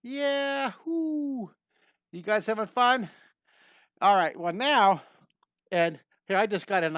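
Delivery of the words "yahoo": high, drawn-out cowboy-style yahoo; gleeful high holler; exuberant whoop. Acted. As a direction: whoop it like a rider taking off. Whooping as the drawn riders zoom.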